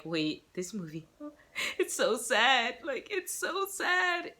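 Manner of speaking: high, slow, laughing fake sob; hammed-up whimper over a sad movie; mock teary wail. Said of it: "and you're like".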